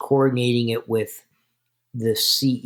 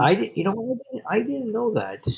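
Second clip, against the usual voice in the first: real surprise; 10 semitones higher than usual. Real, not acted. Guest hears the Canadian alcohol figures, stammering in real surprise. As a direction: pitch jumps way up, startled and stammering.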